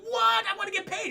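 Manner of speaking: high-pitched yelped protest; indignant protest. Acted. Fired Hobgoblin demands his money, indignant protest. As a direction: shoot up high in mock outrage.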